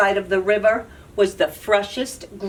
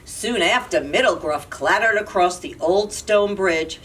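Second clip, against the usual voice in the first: ordinary delivery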